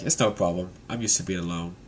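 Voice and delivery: low, soft resignation; downcast Brook. Retold or imitated. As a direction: let it sink down quietly. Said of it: "And Brook's like".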